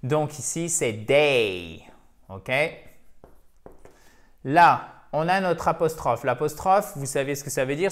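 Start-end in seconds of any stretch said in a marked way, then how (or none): 0.9-1.8 "day" drawn out, gliding from e to i; clear diphthong. Shown as a demonstration. Teacher showing learners the diphthong, "day" with a long ey glide. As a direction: lengthen "day" like the end of "oseille". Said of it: "c'est le E de oseille".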